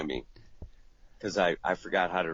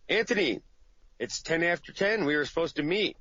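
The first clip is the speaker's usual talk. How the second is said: higher annoyed caller voice; answering machine caller; 8 semitones higher than usual. Retold or imitated. A client's voicemail, annoyed about a missed meeting. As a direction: leave an irritated voicemail. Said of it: "my bad news machine tells me".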